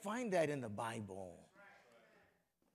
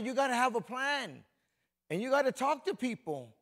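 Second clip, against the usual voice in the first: ordinary delivery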